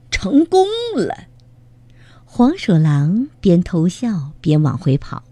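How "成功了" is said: triumphant, high-pitched; gleeful cry. A narrator's reading. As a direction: crow over a trick that worked. Weasel's trick has worked.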